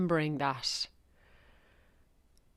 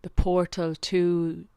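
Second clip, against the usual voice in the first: guided meditation. Acted. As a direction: guide listeners gently down into the heart. Leads a heart meditation.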